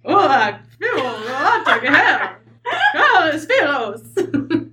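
slow, drawn-out horsey voice; horse-flavored alien speech. Shown as a demonstration. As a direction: stretch it out like a whinnying horse. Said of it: "add some horse flavor to that".

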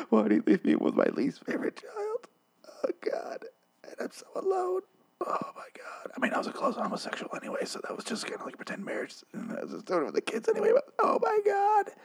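high, whimpering fake cry; mock sobbing. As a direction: wail softly with breathless, hammed-up sobs. Acts dad crying alone.

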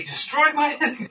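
hammed-up fierce request; a laughing mock psalm curse; strong demand. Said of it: "Sometimes he says it pretty strongly".

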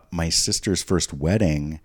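ordinary talk, nothing marked

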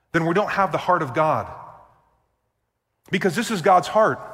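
loud, high, fervent preaching. Acted. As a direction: preach it out with full force. Preaching at full force.

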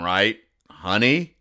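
ordinary talk, nothing marked

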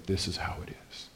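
hushed, understated statement of fact; quiet certainty. Retold or imitated. Preacher voices Jesus stating truth without credentials, quiet certainty. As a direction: drop it right down, quietly sure.

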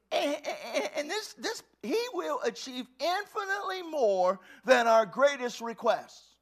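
tearful, high and loud; voice breaking. Real, not acted. Preacher overcome while reading scripture, tearful. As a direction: let emotion crack through.

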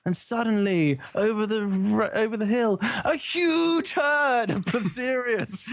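high, hammed-up documentary narration; Kenneth Branagh impression. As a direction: build to a grand reveal, drawing out the last words. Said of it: "put on my best Kenneth Branagh voice".

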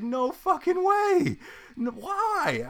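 high, slow, floored outcry; stunned disbelief. Retold or imitated. The whole building hearing the news, high stunned outcry. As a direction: act totally floored. Imagined coworkers' reaction.

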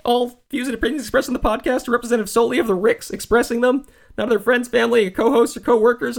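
high-pitched, stammering cartoon kid impression; Morty. Podcast host thanking dear listeners, in a high Morty voice. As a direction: read the sign-off in a high cartoon-boy voice. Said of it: "in the Morty voice".

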